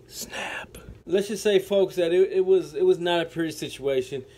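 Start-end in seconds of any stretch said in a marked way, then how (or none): 0.1-0.8 whispered snap. Acted. Storyteller whispering the sound of the snapped finger. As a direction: breathe the word with no voice.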